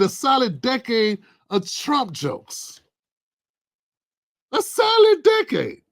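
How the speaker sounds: solid decade drawn out, then decade pitched high; exasperated emphasis. Real, not acted. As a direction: stress solid decade with disbelief. Repeats phrase in exasperation.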